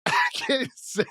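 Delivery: laughing. Real, not acted. Laughing before reading quote.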